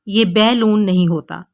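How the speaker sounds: usual speaking voice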